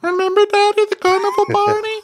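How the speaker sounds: very high, loud Beaker-style squeak; Muppet-like squeaky voice. Retold or imitated. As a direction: pour out the story in a tiny squeaky voice. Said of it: "in that voice".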